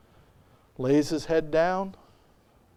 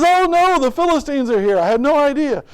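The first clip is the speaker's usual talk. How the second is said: high, loud fake panic; mock alarm; 10 semitones higher, 7 dB louder than usual. Delilah fakes alarm to Samson, high mock panic. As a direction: cry out a staged warning. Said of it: "And he says".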